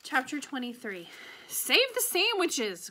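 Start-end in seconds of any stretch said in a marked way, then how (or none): 1.5-2.9 high, slow yell over the rain. A narrator's reading. Gloria yelling to Opal over the rain, loud high yell. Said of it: "Gloria Dump yelled to me".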